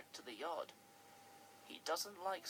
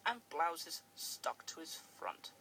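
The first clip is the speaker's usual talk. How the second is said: usual speaking voice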